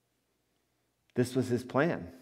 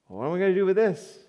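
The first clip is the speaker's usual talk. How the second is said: quicker, higher burst of alarm; Joseph's sudden panic, rushed and raised; panicked dismay; 6 semitones higher, 4 dB louder, faster than usual. Retold or imitated. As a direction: blurt it out like the plan just fell apart. Joseph panicking at pregnancy news.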